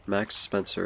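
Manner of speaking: ordinary talk, nothing marked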